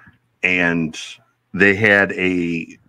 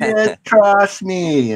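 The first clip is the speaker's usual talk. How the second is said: put-on Jamaican accent; 10 semitones higher, much slower than usual. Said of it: "he's like".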